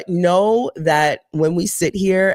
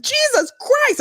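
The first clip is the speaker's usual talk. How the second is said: exasperated. Retold or imitated. Fed up with the 911 hold.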